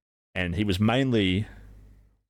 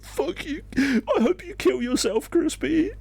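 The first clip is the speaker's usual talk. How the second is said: shrill mock sobbing scream, much higher; mock tearful shriek. Gamer girl screams at Crispy, mocking tearful shriek. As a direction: imitate her wailing through fake tears. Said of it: "bursting into tears".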